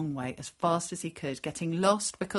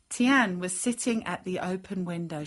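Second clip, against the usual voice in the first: ordinary delivery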